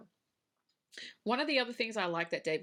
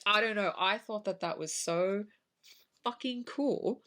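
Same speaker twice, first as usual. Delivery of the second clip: usual speaking voice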